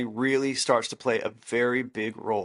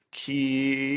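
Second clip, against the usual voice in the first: ordinary delivery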